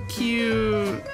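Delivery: ordinary talk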